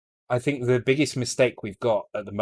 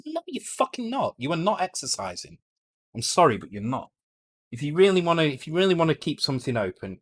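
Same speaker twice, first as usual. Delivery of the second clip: real angry rant, pitched high; heated, high-pitched indignation; getting angry; 6 semitones higher, 4 dB louder than usual. Real, not acted. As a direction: shoot down the excuse, indignant and higher. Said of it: "Sorry, I'm getting angry".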